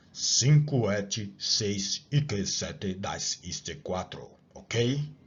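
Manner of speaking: slow, faked German accent. Shown as a demonstration. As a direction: mumble it like a German. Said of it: "vou tentar dar uma enrolada aqui".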